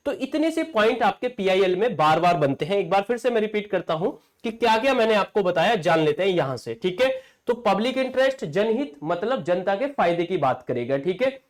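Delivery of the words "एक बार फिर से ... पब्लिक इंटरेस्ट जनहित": normal talking voice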